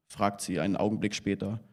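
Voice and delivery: ordinary delivery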